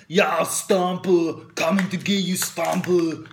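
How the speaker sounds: mock Schwarzenegger threat, dragged out slow; Schwarzenegger accent. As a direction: menace them like Arnold Schwarzenegger, very slowly. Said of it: "like, a Schwarzenegger accent".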